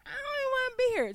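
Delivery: high, fast, exasperated quote; fed-up inner voice. Retold or imitated. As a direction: squeal it like you want out right now. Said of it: "You like".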